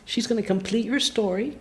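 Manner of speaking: soft, measured fortune-telling, retold; hushed prophecy. Psychic telling her future to her, hushed. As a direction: deliver the revelation softly, pausing between sentences.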